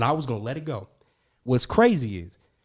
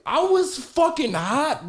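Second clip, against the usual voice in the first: slow, high, laughing through it; amused fury; laughing anger; 13 semitones higher, 4 dB louder, much slower than usual. Real, not acted. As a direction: say it laughing at how mad you were. Still laughing at the memory.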